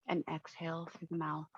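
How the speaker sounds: soft, even meditation voice with long pauses; unhurried, hushed visualization narration; guided meditation. Acted. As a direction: guide listeners gently into calm, leaving space between phrases.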